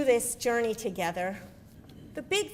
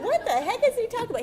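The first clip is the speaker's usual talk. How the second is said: baffled thought; 12 semitones higher, 5 dB louder, faster than usual. Retold or imitated. Confused inner thought, retold.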